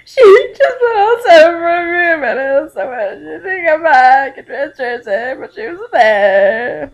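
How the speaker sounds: high, loud hammed-up wail; over-the-top sobbing mother, slow and wailing. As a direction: drag the words out in mock sobs.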